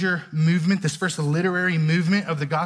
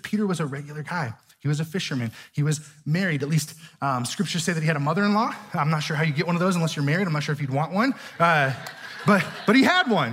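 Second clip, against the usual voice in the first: ordinary delivery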